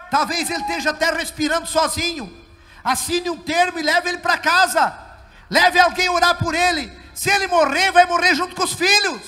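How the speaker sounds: urgent retold advice. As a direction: press the father, voice lifted like a preacher. Retold doctor's advice, preached louder.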